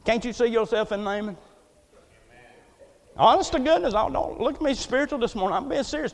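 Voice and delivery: usual speaking voice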